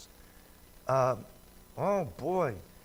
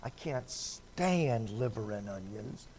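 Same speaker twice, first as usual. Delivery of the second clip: ordinary talk, nothing marked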